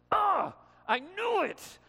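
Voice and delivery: high, loud, drawn-out mock exasperation; frustrated outburst. Jonah seeing Nineveh turn from wickedness, frustrated outburst. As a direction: cry out in acted frustration. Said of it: "he's frustrated and he's angry at God".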